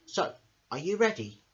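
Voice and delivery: plain reading voice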